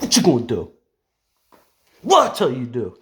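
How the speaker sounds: loud, high-pitched demand; stern with kids. Acted. Aunt making the twins pick up toys, loud stern scolding. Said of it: "That's how I be with the twins".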